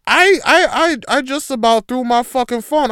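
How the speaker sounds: loud, high and fed up; outraged shout. Real, not acted. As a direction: let the rage spill out. Outraged rant about raw beef.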